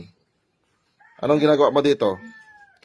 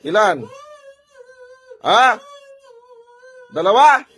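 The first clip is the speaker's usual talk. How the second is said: high, loud, pressing questions; stern interrogation; 6 dB louder than usual. Real, not acted. Healer asking spirits how many they are, stern interrogation. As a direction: push each short question up and out.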